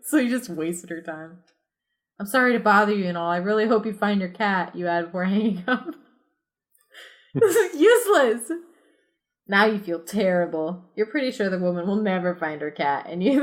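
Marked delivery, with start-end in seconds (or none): 2.2-4.8 fast, hurried apology; rushed. Acted. 7.3-8.5 frustrated. Acted. Kid frustrated.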